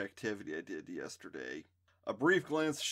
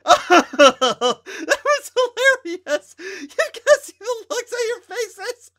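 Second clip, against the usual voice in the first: high, loud, slow laughing squeal; laughing hard; 18 dB louder, much slower than usual. A narrator's reading. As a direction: laugh through the words. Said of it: "Candace burst out laughing".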